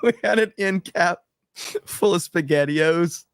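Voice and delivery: high, giggly retelling; barely holding back laughter while talking; laughing through the story. Real, not acted. Cracking up over an old manager story, laughing as he talks. Telling a story he finds hilarious.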